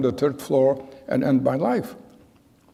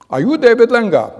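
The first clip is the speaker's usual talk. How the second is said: loud, higher demanding question; menacing guard; 9 semitones higher, 8 dB louder than usual. Retold or imitated. Burly guard at the door demanding the boy's name, loud and hard. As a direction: bark the name at a frightened boy.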